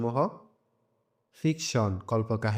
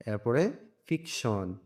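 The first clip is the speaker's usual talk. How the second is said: ordinary delivery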